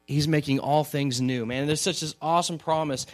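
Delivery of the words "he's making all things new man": normal talking voice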